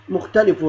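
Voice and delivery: "مختلف" ending in -un; "مختلف" pronounced mukhtalifun with a clear final n; tanween damm. Shown as a demonstration. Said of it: "تنوين ضم".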